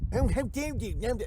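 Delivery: a hint of pompous offence; mock outrage. Streamer echoing a chat catchphrase in a high, slow mock-offended voice. Mocking Trump copying the chat.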